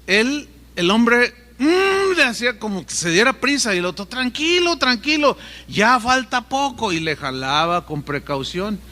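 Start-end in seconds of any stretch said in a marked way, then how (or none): 1.6-2.2 muffled urging hum. Retold or imitated. Man urges with rope in mouth. 4.1-6.9 soothing rescuer. Retold or imitated. Rescuer calms the panicking man.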